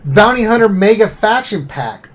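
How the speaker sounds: high, loud all-caps announcement; all-caps yelling. Acted. Reading an all-caps pack name to listeners, mock yelling. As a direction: read it out as if yelled in capitals. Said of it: "They're yelling at us".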